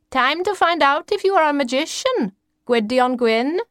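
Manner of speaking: high, portentous grandmother voice; mysterious grandmother. A narrator's reading. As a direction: pitch it high, like a grandmother announcing destiny.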